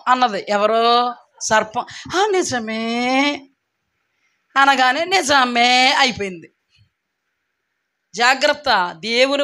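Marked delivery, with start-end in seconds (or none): 2.2-3.3 very drawn-out, gullible-sounding assent; slow imitation of naive agreement; mock credulous agreement. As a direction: stretch the words as if easily taken in. Mimics gullible agreement to the serpent.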